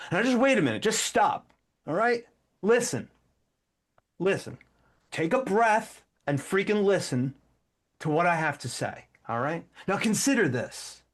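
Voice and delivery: impatient man ordering the listener to stop and listen; mock angry lecture to a distracted listener; kinda angry tone. Shown as a demonstration. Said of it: "Now, I've got some kinda angry tone".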